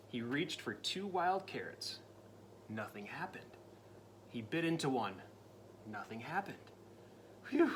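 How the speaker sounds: ordinary delivery, nothing marked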